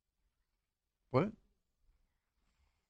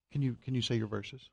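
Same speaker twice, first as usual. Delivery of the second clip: gentle coaxing; 3 semitones lower, 8 dB quieter than usual. Real, not acted. Coaxing son to recite.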